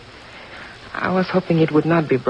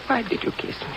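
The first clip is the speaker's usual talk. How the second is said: breathy near-whisper; 7 semitones higher, 7 dB quieter than usual. Acted. Intimate question after a kiss.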